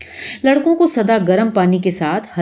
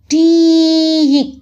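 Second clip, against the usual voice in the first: long, high kite cry; high sustained bird call; kite's screech; 8 semitones higher, 4 dB louder than usual. A narrator's reading. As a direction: screech one drawn-out note like a bird. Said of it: "چیل نے پھر ٹھنکاری بھری".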